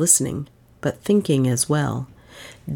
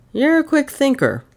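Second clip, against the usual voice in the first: raised pitch with obvious unbelief; disbelieving; 11 semitones higher, 3 dB louder than usual. A narrator's reading. Detective doubting the aunt's quick explanation, openly disbelieving. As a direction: let them hear you don't believe it. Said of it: "with obvious unbelief in his voice".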